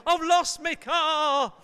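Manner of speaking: loud, slow panicked cry. Retold or imitated. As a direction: cry out in alarm at the empty space. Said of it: "and you go".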